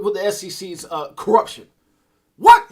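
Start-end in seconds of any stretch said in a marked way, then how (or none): 2.4-2.7 loud, high "What?"; stunned. Real, not acted. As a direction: shout it in shock. Stunned reaction mid-rant.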